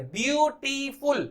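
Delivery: Beautiful slowly split as byoo, tee, ful; syllable by syllable. Shown as a demonstration. Teacher breaking the word into three syllables, slowly. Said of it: "तीन syllable का word".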